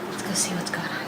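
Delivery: sneaky hushed whisper. Real, not acted. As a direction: creep in, whispering. Sneaking up on fighting kids.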